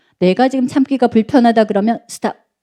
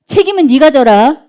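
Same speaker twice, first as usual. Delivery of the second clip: mock parent, raised and louder; washing hands of it; 5 semitones higher, 6 dB louder than usual. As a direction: dump it on the kid as a fed-up parent. Acted parent washing hands of teen. (손 떼는 부모의 쏘아붙이는 말투)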